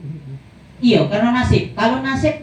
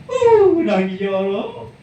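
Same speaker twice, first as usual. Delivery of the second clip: mock crying; slower than usual. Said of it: "Nangis Bu Haja ini".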